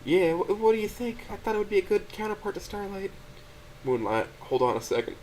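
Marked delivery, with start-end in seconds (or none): 0.0-3.1 put-on a crying girlfriend pleading to help; high, gentle, tearful voice; tearful Stella. A narrator's reading. Stella's voice in the story.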